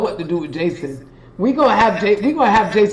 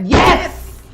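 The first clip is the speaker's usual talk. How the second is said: high, loud burst of joy; a jubilant yes; triumphant shout; 8 semitones higher, 5 dB louder than usual. Real, not acted. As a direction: cheer the win out loud. Triumphant cry as [name] types it.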